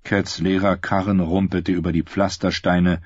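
ordinary delivery

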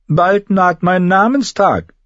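put-on high, haughty boy-king voice; young king Joffrey. A narrator's reading. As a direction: look her over like a spoilt king. (hoher, hochmütiger Knabenkönig)

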